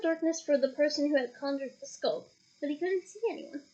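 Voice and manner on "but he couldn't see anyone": ordinary delivery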